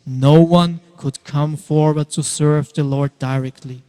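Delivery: ordinary delivery, nothing marked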